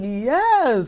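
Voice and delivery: high-pitched, elated yes; excited reaction. Retold or imitated. Listener tells of hearing that song, elated.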